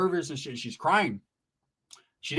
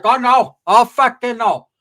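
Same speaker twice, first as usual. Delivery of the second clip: higher, loud, surprised aunt; higher auntie voice exclaiming; aunt's voice; 6 semitones higher, 6 dB louder than usual. Retold or imitated. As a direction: play the auntie shocked at the crowd. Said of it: "my tia's like".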